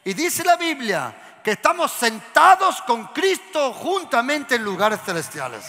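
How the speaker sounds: loud, high proclaiming shout; preaching shout. Real, not acted. Preacher shouting to the congregation, loud and high. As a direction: belt it out to the whole church.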